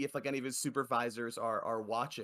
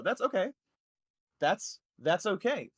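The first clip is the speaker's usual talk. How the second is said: mock consoling; 6 semitones higher than usual.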